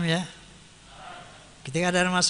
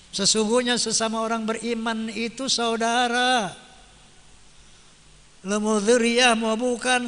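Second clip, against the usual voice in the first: normal talking voice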